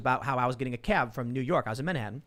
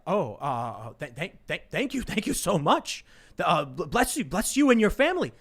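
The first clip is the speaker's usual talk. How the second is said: stunned gratitude; 2 semitones higher than usual. Retold or imitated. Cabbie thanking passenger for doubled fare, stunned and stammering. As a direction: act out a flabbergasted cabbie, building from halting to effusive. Cabbie overwhelmed by a huge tip.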